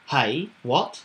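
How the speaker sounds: usual speaking voice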